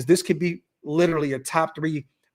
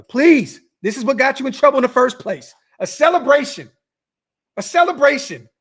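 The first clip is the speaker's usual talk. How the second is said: raised, higher pleading scold; exasperated scolding; 7 semitones higher, 6 dB louder than usual. Real, not acted. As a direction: beg him loudly to knock it off. Host scolding player over celebration.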